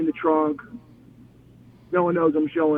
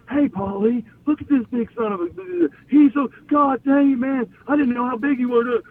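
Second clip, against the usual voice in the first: high-pitched imitation of an amazed colleague; excited calling over; 8 semitones higher than usual. Acted Dreamer excitedly calling Paulie over.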